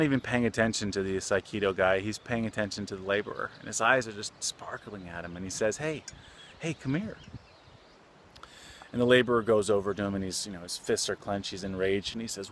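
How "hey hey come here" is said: put-on sweet old man. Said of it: "sweet-looking old, old man".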